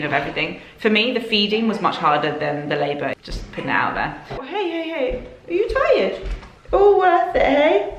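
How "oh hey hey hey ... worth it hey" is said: very high, cooing sing-song to an infant. Real, not acted. Mother talking to her tired baby, high cooing baby talk.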